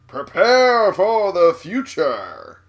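slow, booming billboard announcer. Pretend. Player reads a game billboard in announcer voice. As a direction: proclaim it slowly like an old ad.

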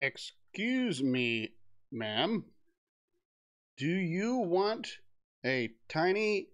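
drawn-out polite sales pitch; slow sales pitch. Pretend. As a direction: sell it slowly and sweetly.

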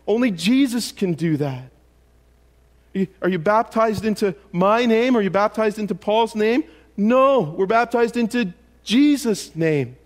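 fiery preaching. Acted. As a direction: drive each question home to the congregation. Preaches Corinthians at full force.

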